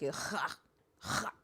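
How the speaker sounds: "ha" with a guttural rasped first sound; throaty demo. Shown as a demonstration. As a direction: demonstrate "ha" like clearing the throat. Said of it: "I just go like".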